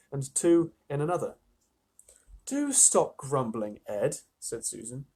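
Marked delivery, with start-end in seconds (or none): 2.4-4.2 slow scolding. Acted. Susan scolding Edmund.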